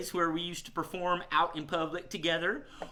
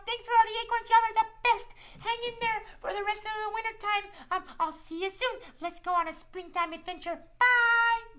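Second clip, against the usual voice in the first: much higher, cheerful little squirrel; a friendly shy squirrel talking to kids; squirrel puppet voice; 3 dB louder than usual. Acted. Squirrel puppet Shiloh tells the kids about winter, high cheerful voice.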